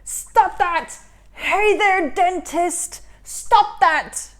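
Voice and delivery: furious shout. Acted. Karius yelling at the dentist, furious shout. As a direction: yell at the dentist to stop. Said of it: "so furious he was ready to pop".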